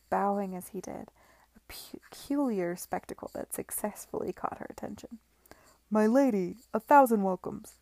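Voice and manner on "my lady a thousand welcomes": much louder, eager booming welcome; cried greeting. A narrator's reading. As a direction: call out to her over the crowd. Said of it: "he cried".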